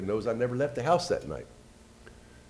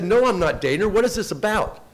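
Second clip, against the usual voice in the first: retold protest, higher and louder; indignant denial; 9 semitones higher, 7 dB louder than usual. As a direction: push back at the accusation, voice raised. Retold denial of detective's accusation.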